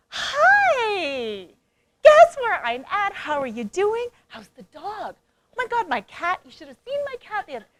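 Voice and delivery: mock-cheery call to a friend from jail; chatty rambling call. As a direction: gush about the cat as if nothing's wrong. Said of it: "Are you gonna talk like that?".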